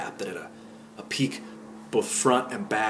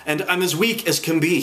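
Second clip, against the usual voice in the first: higher, lightly acted strain; a touch of feigned feebleness, pitched up; gasping; 6 semitones higher, 4 dB louder than usual. A narrator's reading. Man staggering under his hat, mildly gasping. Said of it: "he gasped".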